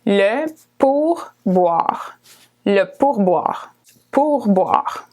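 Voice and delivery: le pourboire drawn out syllable by syllable; slow clear French. Shown as a demonstration. Teacher to students learning restaurant words, slow and clear. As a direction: spell out "Le pourboire" slowly for learners. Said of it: "a tip in French is pourboire".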